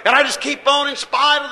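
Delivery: loud, high, defiant cry of praise; a preacher shouting his defiance. Real, not acted. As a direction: shout it back at the critics.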